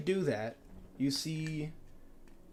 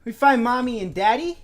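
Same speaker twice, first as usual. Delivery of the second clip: put-on higher and louder, childlike question; little girl; 7 semitones higher, 7 dB louder than usual. Game master as the little girl Blue, asking for her parents, childlike. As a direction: ask hopefully like a small child.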